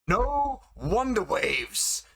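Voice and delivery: high, drawn-out proclamation; booming verdict. Acted. Judge who shot the player to the crowd, drawn-out high call. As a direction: call it out slowly across the hall.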